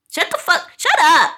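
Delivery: yelling shut up. Acted. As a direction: snap and yell them down. Telling off the imagined anti-vaxxer.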